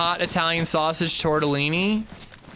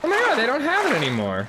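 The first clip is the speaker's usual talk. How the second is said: high, fast disbelief; shocked outcry; 11 semitones higher, faster than usual. Real, not acted. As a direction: blurt it out in sudden dismay. Recipe site no longer has it.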